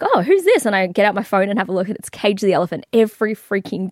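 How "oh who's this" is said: high, loud surprised question. Retold or imitated. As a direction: pop up in surprise. Quoting own surprise.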